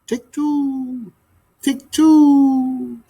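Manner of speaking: high falling coo; murmuring dove coo. Acted. Dove gliding away, murmuring her coo. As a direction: coo it to yourself as you glide off.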